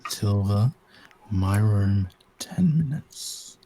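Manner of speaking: slow husky whispered summons; sexy whisper. Pretend. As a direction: whisper the order, teasing. Seductive order continues roleplay.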